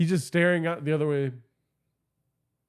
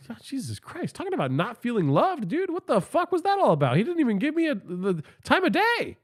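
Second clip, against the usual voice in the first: higher, whining complaint; whiny rant; 7 semitones higher than usual. Retold or imitated. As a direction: whine like you feel unloved. Whining about being ignored.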